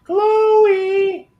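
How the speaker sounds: high sing-song call across the house; calling the dog. Real, not acted.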